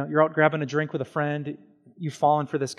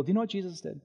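normal talking voice

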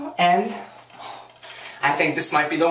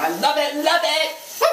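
higher, gushing delight; excited gush; 10 semitones higher than usual. Real, not acted. A vlogger gushing over the song. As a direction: squeal your love for it.

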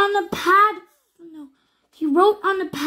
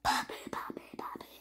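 whispering; 18 dB quieter, much faster than usual. Real, not acted. Child whispers sounds.

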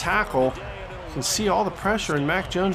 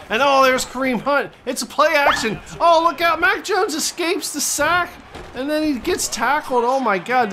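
much higher and louder, near a shout; excited call; 8 semitones higher, 3 dB louder than usual. Acted. Commentator calling Mac Jones escaping the sack, excited shout. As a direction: ride the scramble like a hyped announcer. Wild play action scramble.

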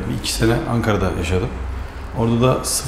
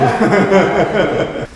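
frustrated laugh; 7 semitones higher than usual. Real, not acted. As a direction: burst of exasperated laughter. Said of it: "sinirden gülüyorum".

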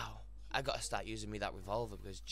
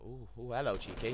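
normal talking voice

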